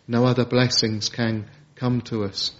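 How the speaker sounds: normal talking voice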